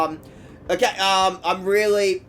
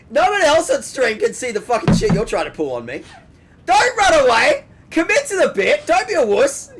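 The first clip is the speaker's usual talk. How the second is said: teasing loud; 10 semitones higher, 6 dB louder than usual. Real, not acted. Teasing friend into eating on stream.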